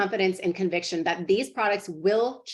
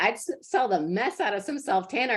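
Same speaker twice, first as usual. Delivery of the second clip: put-on a light Southern accent, pitched up; imitating a Southern girl; 4 semitones higher than usual. Quoting the Southern daughter's pitch, in a Southern accent. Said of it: "the way she said it".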